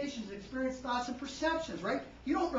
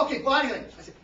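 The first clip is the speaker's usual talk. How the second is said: normal talking voice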